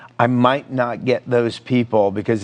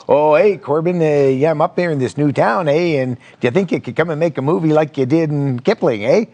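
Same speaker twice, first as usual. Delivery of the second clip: best hammed-up Canadian accent, slightly higher; 3 semitones higher than usual. Town official pitching a film to the director, in a Canadian accent. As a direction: put on a folksy Canadian "eh?" voice. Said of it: "I'm gonna use my best Canadian accent".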